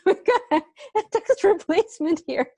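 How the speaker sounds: teacher amused by a text replacement. Real, not acted. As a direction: tease through giggles. Laughing at autocorrect answer.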